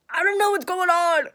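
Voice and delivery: loud, high panicked outburst; acted desperate wail; retold distress. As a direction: cry it out, pained and bewildered. Retells crying out in pain.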